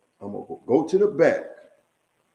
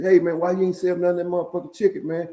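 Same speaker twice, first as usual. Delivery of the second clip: quick, higher-pitched imitated complaint; complaining customer; 5 semitones higher, faster than usual. Customer getting at the seller over unsold chicken, imitated complaint. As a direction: rattle it off as an aggrieved buyer.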